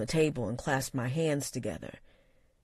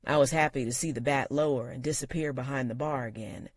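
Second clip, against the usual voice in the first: ordinary delivery, nothing marked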